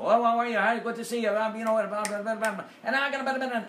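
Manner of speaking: high, loud, rushed comic patter; mocking comics afraid of silence; nervous rushed comic. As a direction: gush at the crowd like a panicky comic. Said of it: "they come out and they go".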